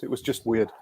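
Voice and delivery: usual speaking voice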